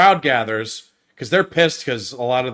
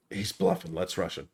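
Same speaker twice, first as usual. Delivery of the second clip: rushed demo; 6 semitones lower, 12 dB quieter than usual. Shown as a demonstration. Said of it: "This is how fast it is".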